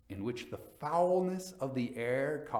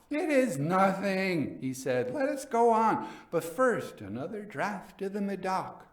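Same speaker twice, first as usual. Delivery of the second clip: higher and louder, pushing on; eager drunk Fortunato; 8 semitones higher, 5 dB louder than usual. A narrator's reading. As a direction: wave it off and demand more wine.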